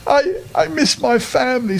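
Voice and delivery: a homesick little bear, a touch tearful; sad teddy bear. Acted. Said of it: "said Ernest sadly".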